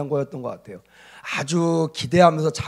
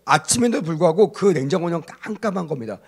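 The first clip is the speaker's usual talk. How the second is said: ordinary delivery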